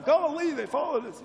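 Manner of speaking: high-pitched urgent yelp, mock-alarmed; urgent warning. Acting out a movie hero warning his companion, urgent. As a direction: cry out a hurried warning, acted. Acting Luke's panicked film lines.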